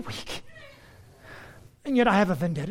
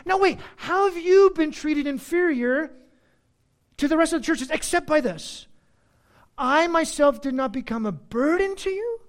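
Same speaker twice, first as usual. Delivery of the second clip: higher, louder, pressing the question; reading Paul with force; 7 semitones higher, 5 dB louder than usual. Retold or imitated. A preacher voicing Paul confronting the Corinthians, higher and louder. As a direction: quote Paul pointedly. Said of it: "First he says".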